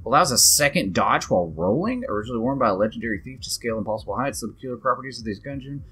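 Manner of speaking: low, soft read-aloud voice; reading item text. Real, not acted. Player reading out a boots description, low read-aloud.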